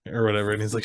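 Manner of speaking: normal talking voice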